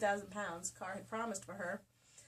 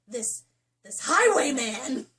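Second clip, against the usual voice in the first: spat question; 4 semitones higher, 13 dB louder, slower than usual. Acted. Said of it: "Carr spat".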